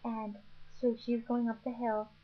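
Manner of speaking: ordinary talk, nothing marked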